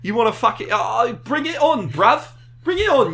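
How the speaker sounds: put-on loud, high London gangster accent, taunting; brawl-ready London gangster accent. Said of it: "I'm thinking London gangster".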